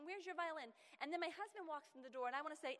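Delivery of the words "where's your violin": higher, louder mom barking orders; busy mom's bossy call. Retold or imitated. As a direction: snap out chores at the kids. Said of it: "You're barking out orders all day".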